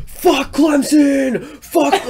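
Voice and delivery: high, drawn-out shout of a rowdy fan; loud mock crowd chant, stretched out; imitated yelling. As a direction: yell it like a house full of fans. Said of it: "they're just yelling it over and over".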